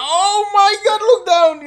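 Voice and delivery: high, loud squeal; a mock panicked squeal; panicked squeak. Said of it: "I would be like".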